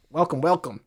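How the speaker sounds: lightly imitated robot greeter; a hint of automated machine. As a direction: give a small robotic greeting. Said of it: "said to me in a robot voice".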